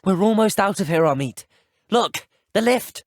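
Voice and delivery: calling out high and loud to a companion; excited urgent call. Acted. Telling Amit they're almost out, excited call.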